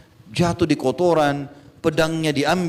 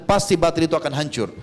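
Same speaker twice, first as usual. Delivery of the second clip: ordinary talk, nothing marked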